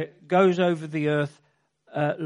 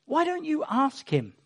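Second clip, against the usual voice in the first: imitating sister; 8 semitones higher than usual. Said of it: "And then she said".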